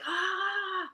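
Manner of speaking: high, startled "Ah!" in a mock Kelly Holmes gasp; hammed-up shock at the finish line; a race-finish gasp, imitated; imitating a startled gasp. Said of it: "she's like".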